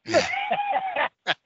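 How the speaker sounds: high-pitched burst of laughter. Real, not acted. Storyteller cracking up over the old promoter, high laugh.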